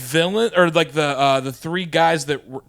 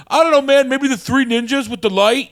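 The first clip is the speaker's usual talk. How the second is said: lightly voiced laid-back guest, higher; a hint of a dude voice, higher and louder; mock casual guest; 10 semitones higher, 6 dB louder than usual. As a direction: toss it off like a shrugging fan. Imagined guest's answer.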